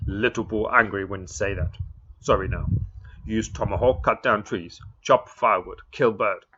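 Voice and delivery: loud, blunt demands in broken English; Little Bull demanding. Acted. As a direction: bark out each demand like a proud chief.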